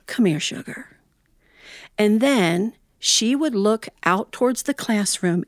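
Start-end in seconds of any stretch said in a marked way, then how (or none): 0.1-1.0 put-on soft, gentle call to a child. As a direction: coax the child over softly. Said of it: "she would gently call us".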